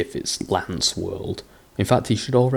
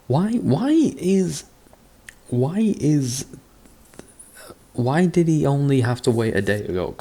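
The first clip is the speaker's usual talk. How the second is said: baffled; 6 semitones higher than usual. Real, not acted. Baffled by story logic.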